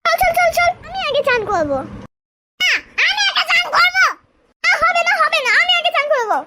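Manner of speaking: put-on high, insistent childish squabble; both kids shout to go first; squabbling siblings. Two siblings fighting to bathe first, high insistent squabble.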